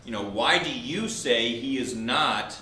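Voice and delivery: normal talking voice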